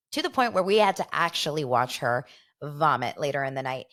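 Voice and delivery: ordinary talk, nothing marked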